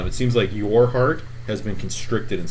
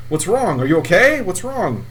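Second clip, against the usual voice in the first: high, louder anxious questions; worried NPC; 9 semitones higher, 5 dB louder than usual. Acted. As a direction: fret over the fallen hero. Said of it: "he says".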